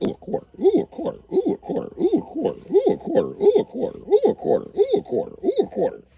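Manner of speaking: put-on high squeaky kid voices; kids' squeals. Daughters spotting coins, high excited squeals. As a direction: squeal it like greedy little kids. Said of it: "They be like".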